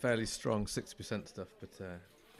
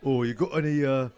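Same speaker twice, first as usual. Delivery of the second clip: loud, high put-on Cockney; Cockney accent; 4 semitones higher, 8 dB louder than usual. Customer orders cider from the landlord, mock Cockney accent. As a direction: play a Cockney cider drinker at the bar. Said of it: "my cider accent is, is a Cockney one".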